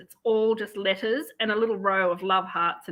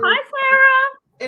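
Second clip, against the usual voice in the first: sing-song greeting; 10 dB louder, slower than usual. Real, not acted. Calling out a greeting to a viewer.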